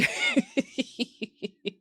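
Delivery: a burst of high-pitched laughter. Real, not acted. After joking she ate the cat, high laughter. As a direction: crack up high at your own joke.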